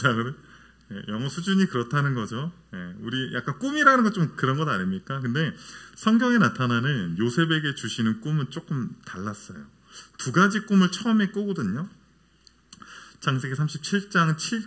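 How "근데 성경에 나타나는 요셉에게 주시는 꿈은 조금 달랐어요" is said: ordinary talk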